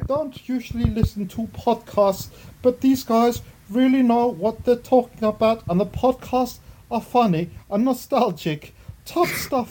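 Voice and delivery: hammed-up Chris Eubank; Chris Eubank impression. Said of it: "In my Chris Eubank".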